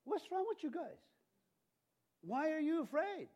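very high-pitched, baffled reproach; incredulous questioning. Acted. Jesus to the frightened disciples, incredulous. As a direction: ask it high, in comic disbelief.

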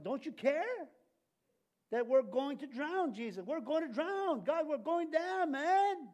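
high, loud panicked disciples; frantic plea to Jesus in the storm; panicked cry. Acted. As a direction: cry out like drowning men.

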